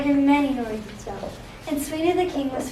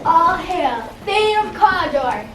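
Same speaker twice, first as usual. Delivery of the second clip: hailing witch; 5 semitones higher, 7 dB louder, slower than usual. Acted. Second witch hails.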